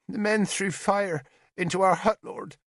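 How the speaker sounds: put-on high, small child's voice fading to nothing; a little girl barely daring to speak. Child telling the bishop of the raid in a small voice. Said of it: "Salina said in a small voice".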